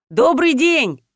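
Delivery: usual speaking voice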